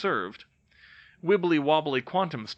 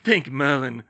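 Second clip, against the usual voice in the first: relieved; 8 dB louder than usual. Acted. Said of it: "Marcus said".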